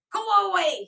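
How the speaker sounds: loud, high scream. A narrator's reading. Boy screaming from the bed in a tantrum. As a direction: scream at everyone to leave. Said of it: "he screamed".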